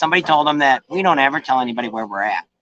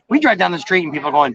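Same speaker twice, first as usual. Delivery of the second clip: normal talking voice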